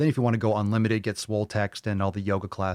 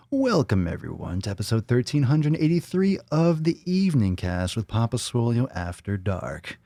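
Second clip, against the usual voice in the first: late-night show host; 3 dB louder than usual. Acted. Podcast host welcoming everyone to the evening cast, announcer style. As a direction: belt out a show opening, slowing into the title.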